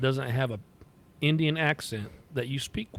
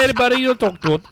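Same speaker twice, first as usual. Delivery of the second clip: lightly imitated Indian accent, higher and louder; a hint of an Indian call-center voice; mock Indian accent; 9 semitones higher, 8 dB louder, faster than usual. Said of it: "sounds like this".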